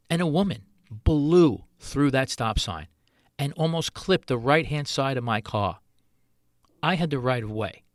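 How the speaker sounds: ordinary talk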